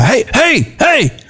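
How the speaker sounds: high-pitched calling out; mock yelling. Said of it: "the guy keeps yelling".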